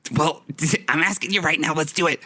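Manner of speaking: high, put-on urging voice; eager character. Skippy urges Sylvester on, eager high voice. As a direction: egg them on in a high character voice.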